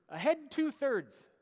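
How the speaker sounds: loud, slow command to the crew; barked order. Retold or imitated. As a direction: call it out like a captain on deck. Captain giving an order to crew.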